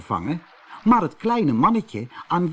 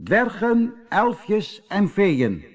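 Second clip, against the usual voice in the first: high, slow call to the crowd; little man hails dwarfs, elves and fairies, high and drawn out; 7 semitones higher, much slower than usual. A narrator's reading. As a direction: summon the whole cave to listen. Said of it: "riep het kleine mannetje".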